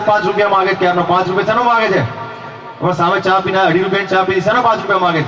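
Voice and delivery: ordinary delivery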